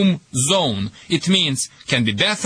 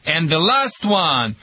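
normal talking voice

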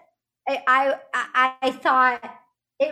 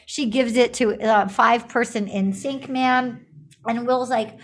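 ordinary delivery

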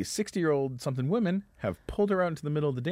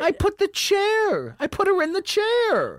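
high, drawn-out screechy complaint; mock falsetto outrage, stretching the words; exasperated woman; 3 dB louder, slower than usual. Lady scolds him, retold.

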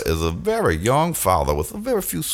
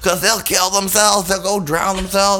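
urgent comic warning; 10 semitones higher than usual. Acted.

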